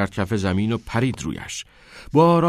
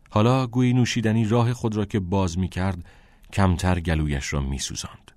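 ordinary delivery, nothing marked

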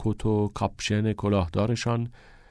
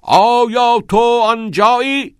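much higher, loud, slow bellow of آیا تو آنجایی؟; roaring; 14 semitones higher, 9 dB louder, slower than usual. A narrator's reading. Todd roaring the line after Neil's urging, bellowing. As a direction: roar آیا تو آنجایی؟ with full force. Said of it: "تاد نعره برآورد".

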